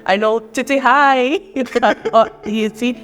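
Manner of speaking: normal talking voice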